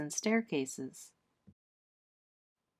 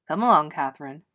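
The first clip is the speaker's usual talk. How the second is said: firm; 5 semitones lower, 6 dB louder, faster than usual. Acted. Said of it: "the girl's father said firmly".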